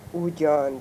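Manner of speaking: usual speaking voice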